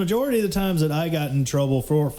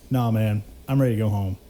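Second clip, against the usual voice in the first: calm refusal; 6 semitones lower, faster than usual. Retold or imitated.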